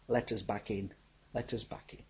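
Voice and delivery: hushed, breathless plea fading to a whisper; pleading whisper. Retold or imitated. Prisoners begging at the door, whispered plea. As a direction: beg softly to be let back in.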